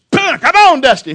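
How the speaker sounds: huge high-pitched driving yell; calling out to a mule. Retold or imitated. Farmer calls his mule the fourth time, shouting. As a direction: bellow the name to make the animal pull. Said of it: "When he called him Dusty".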